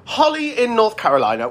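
high, bright greeting; excited greeting. Real, not acted. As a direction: greet her with delight, pitch up. Host greets caller fondly.

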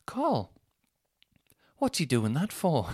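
put-on high, drawn-out Mancunian child; Manchester accent. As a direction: mimic a Manchester kid's puzzled question. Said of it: "I can't do the Manchester accent".

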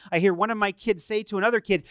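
normal talking voice

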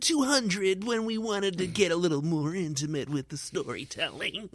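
high, pompous film buff lecturing; film nerd voice. Acted. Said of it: "That's your film nerd voice".

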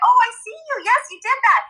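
high encouraging praise; parent praise. Retold or imitated. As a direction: affirm a child warmly. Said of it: "I say that all the time".

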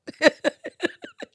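squealing laugh; a high laugh at her own drama. Real, not acted. Joking about the violins being out, high squeal.

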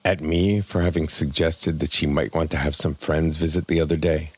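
ordinary delivery

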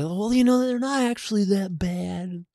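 high, soft placating voice; mild explaining. Retold or imitated. Husband explaining office politics to wife, placating. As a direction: gingerly excuse someone, pitched high and quiet. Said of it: "start to explain the politics of it".

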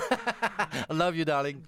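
slow and tender, a laugh still in the voice; tender laughing I love you. Real, not acted. Proud father to his daughter on air, tender and laughing. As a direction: melt a little as you tell her.

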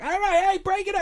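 detective in a put-on Boston accent. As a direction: break up the fight in a Boston accent. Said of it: "the Boston Matt Damon".

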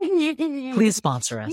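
high, slow begging voice; mock pleading. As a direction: plead sweetly and slowly. Begging the brand to sponsor.

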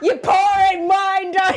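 loud, high and slow, laughing; laughing shout. Real, not acted. Laughing at parody.

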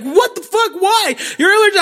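high-pitched frantic disbelief; freaking out. Retold or imitated. Fiancée who thinks he's allergic, high frantic protest. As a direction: imitate a panicked girlfriend demanding answers. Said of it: "my girl freaks the fuck out".

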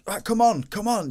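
high, urgent team-rousing call; rallying the team. Retold or imitated. Goalkeeper geeing up his team after conceding, urgent high rallying. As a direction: imitate a keeper geeing everyone up, pitched high. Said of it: "he was getting the, the team".

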